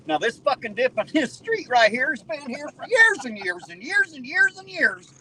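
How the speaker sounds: fed-up driver ranting at the city; exasperated rant. Real, not acted. Ranting about road dip sign.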